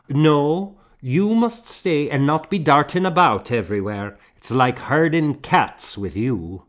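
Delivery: mild stern scold; firm scolding. A narrator's reading. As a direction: shush the children. Morgan scolds children.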